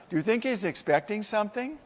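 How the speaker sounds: ordinary talk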